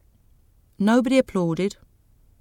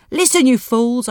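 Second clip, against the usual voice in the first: louder, sharp rebuke to the crowd; angry shout; 4 semitones higher, 7 dB louder than usual. Acted. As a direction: lash out at the audience. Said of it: "I shouted".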